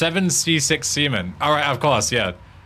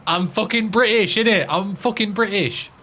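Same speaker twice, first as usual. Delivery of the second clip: mock-proud British accent, pitched higher; hammed-up British "innit", a bit higher; 7 semitones higher than usual. Gamer joking about his bad Swedish, in a British accent. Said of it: "I don't have a perfect fucking Swedish accent".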